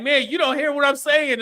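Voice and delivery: mock frustrated wife. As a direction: play the wife, fed up at not being heard.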